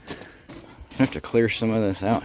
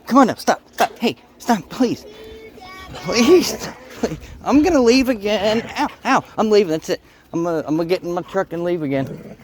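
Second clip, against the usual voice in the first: pleading with dog; 9 semitones higher, 3 dB louder than usual. Real, not acted.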